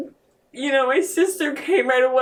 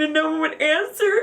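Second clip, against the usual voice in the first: normal talking voice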